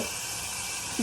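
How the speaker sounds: whispered aside to herself. Real, not acted. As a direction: murmur it low and slow. Agreeing with self, whispered.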